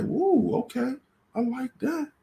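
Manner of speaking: high, charmed mock reaction; smitten. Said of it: "You like".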